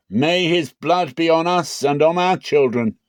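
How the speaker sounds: high, loud crowd declaration; crowd declaring. A narrator's reading. The people answering Pilate, high and loud. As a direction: proclaim it as one loud crowd.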